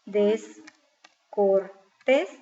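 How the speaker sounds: Descortés very slowly as des, cor, tés; sílaba por sílaba. Shown as a demonstration. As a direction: break Descortés into syllables with pauses. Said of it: "la divido en sílaba". (Descortés separado en sílabas, muy lento)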